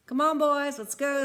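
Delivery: put-on rally the boys to go; calling out. Mister Christmas calling the boys.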